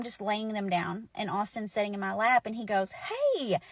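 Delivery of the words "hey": high, drawn-out "hey!"; excited child exclamation. Retold or imitated. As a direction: cry out high like a kid spotting something. Child spots the photo, exclaims.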